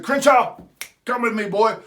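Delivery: a sharp, loud call of a name, then a firmer order; coach calling out. Retold or imitated. Coach calls the boy to his office, a loud stern call. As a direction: mimic the coach barking a summons.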